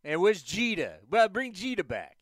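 loud caller with a bad New York accent; hammed-up Bronx fan, New York accent all through. Host spoofs a Bronx radio caller in a New York accent. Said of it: "my bad New York Yankees, uh, accent".

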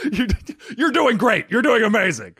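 high, loud mock pep talk; hyped cheering. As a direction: cheer yourself on, hammed up. Yelled positive self-talk, played for laughs.

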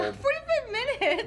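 high, laughing disbelief; incredulous. Real, not acted. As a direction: scoff at the tiny time limit. Incredulous at short time limit.

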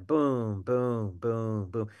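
steady falling "boom" sounds, evenly spaced; imitated slide changes. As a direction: sound out each slide landing, sinking a little each time. Imitating slides shown one after another.

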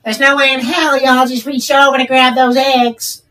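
high, slow, sassy drawl; snippy cashier lady. Retold or imitated. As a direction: play the annoyed lady at the counter. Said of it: "has a unique voice".

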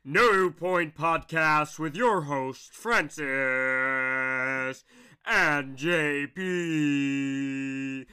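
booming slow ringmaster announcement; deep, drawn-out showman intro. Acted. Podcast announcer opening.

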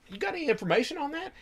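high, fast quoted question; quick high mock question; retold question. Own question voiced high and fast.

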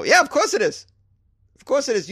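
high, sarcastic scoff; of course it is, sarcastic. Real, not acted. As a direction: roll your eyes and scoff. Sarcastic agreement.

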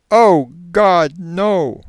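high, loud cry of horror; horrified cry. Acted. Regressed man sees a figure coming, horrified. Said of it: "in a horrified explanation".

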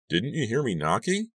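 puzzled father peeking in; dad asking. A narrator's reading. Dad asks his startled daughter, lightly voiced, puzzled. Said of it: "he asked finally".

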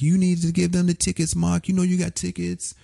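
high-pitched New York lady caller; New York accent. Retold or imitated. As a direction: imitate a scolding New York woman, voice held high. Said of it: "she had this New York accent".

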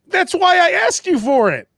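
high-pitched, indignant retort; exasperated rant. Retold or imitated. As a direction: retell it as a worked-up complaint. Retelling his exasperated rant at server.

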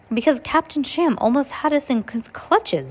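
put-on narrated girl crying out in alarm. A narrator's reading. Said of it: "Violet cried".

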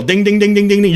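loud, high mock bell ringing; bell noise. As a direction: chant the bell sounds to wake them up. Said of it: "you're ringing a bell here".

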